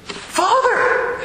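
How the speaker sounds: very high, delighted call of Father; excited greeting. Retold or imitated. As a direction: greet him like an old friend, thrilled. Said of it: "he goes".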